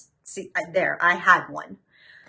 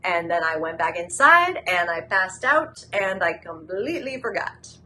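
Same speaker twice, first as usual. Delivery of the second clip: ordinary delivery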